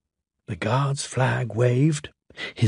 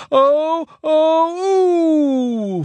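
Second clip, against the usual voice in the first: much higher falling whistle cries; disappointed engine whistle; dismayed whistle; 3 dB louder, much slower than usual. Acted.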